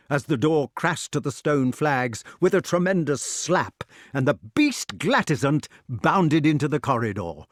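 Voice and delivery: ordinary delivery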